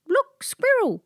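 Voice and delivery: a dog tricking the girl with a fake alarm; barked. A narrator's reading. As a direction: yap it out like an excited dog. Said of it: "barked Oscar".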